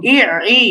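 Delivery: ই squeezed from the middle of the throat; doubled Arabic ayn on ই. Shown as a demonstration. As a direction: draw ই through a tightened throat. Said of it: "গলার মাঝখানে".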